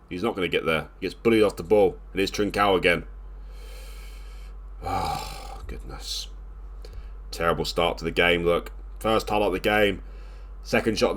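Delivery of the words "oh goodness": dismayed hush. Real, not acted. Own team conceding again.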